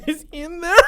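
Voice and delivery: high, laughing, half-crying; squeaked through giggles; laughing quote. Retold or imitated. Quoting again laughing.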